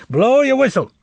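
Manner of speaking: high, loud command; shouted order. A narrator's reading. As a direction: order it across the store. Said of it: "gave the order".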